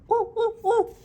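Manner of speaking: very high, loud monkey calls; monkey hoots. A narrator's reading. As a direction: hoot like a cheeky monkey. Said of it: "the monkeys only stamped their feet back at him".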